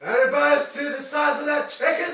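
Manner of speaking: excited shouting. Acted. Shouting about huge chicken.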